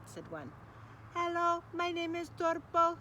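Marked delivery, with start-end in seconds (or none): 1.1-3.0 slow, higher robotic drone; robot dog voice. Acted. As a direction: speak like a toy robot dog. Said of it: "said the other".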